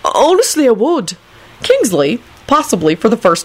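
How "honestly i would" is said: a touch of earnest self-righteousness; mildly drugged sincerity; self-righteous. A narrator's reading. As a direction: insist earnestly, slightly righteous. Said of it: "Neville continued self-righteously".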